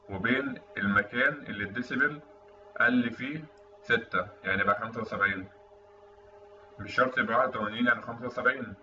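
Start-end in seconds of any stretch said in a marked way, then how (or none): none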